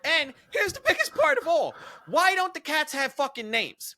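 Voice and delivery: high, loud comic tirade; outraged disbelief at full volume; exasperated rant. Real, not acted. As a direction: build into an incredulous, high-pitched rant. Climactic rant about nameless cats.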